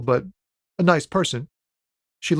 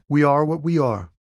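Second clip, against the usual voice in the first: usual speaking voice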